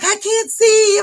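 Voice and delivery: high, loud flustered cry; re-enacted frantic complaint; imitated panic. Retold panicked exchange with husband.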